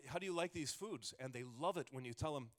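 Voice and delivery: ordinary talk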